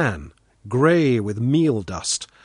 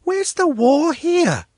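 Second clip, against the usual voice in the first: put-on high, slow little-girl demand; impatient; 4 dB louder, slower than usual. A narrator's reading. Svetlana scanning the empty field, high impatient child voice. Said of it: "Svetlana asked impatiently".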